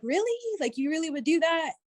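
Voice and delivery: high rising "really", then easing down; retold incredulous question; surprised disbelief. Woman questioning her new suitor's promise, surprised.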